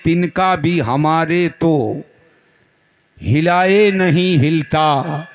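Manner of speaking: slow recital, building to the close; verse recitation. Acted. Preacher quotes Ala Hazrat's couplet, slow measured recitation. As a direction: stretch each phrase, lift the last one.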